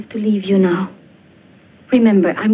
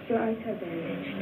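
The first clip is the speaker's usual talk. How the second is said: very soft, soothing murmur; hushed reassurance; 3 semitones higher, 11 dB quieter than usual. Acted. Woman answering her blinded friend, hushed and soothing. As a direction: break it to her very quietly. Calming frightened patient.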